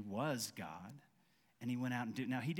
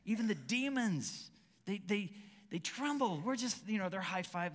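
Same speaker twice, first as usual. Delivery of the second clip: excited disciple; 7 semitones higher, 3 dB louder than usual. Retold or imitated. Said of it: "James is like".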